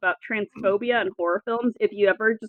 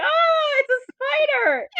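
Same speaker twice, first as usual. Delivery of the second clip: mock scared shriek; slower than usual. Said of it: "she jumps out and she's like".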